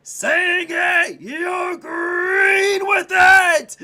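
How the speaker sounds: loud, high, slow belting; belted shout. Real, not acted. Streamer belting the joke to his friend, loud. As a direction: belt it to the rafters.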